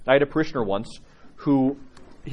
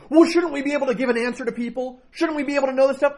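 much higher whiny objecting voice; imitating the parishioner; 14 semitones higher than usual. Priest mimicking the parishioner protesting his advice. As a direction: play him protesting, pitched way up. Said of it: "he stopped me to say".

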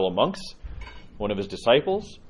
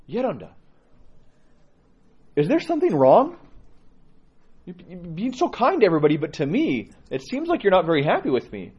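higher, pleading question to the elder; worried pilgrim; 8 semitones higher than usual. Retold or imitated. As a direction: ask the old monk anxiously whether something is wrong. Pilgrim asks elder what's wrong.

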